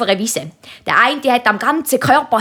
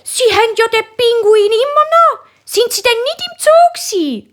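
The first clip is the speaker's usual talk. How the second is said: much higher lady, surprised; higher, puzzled lady's voice; woman surprised to see the penguin still there; 12 semitones higher than usual. Acted. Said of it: "sagt die Frau".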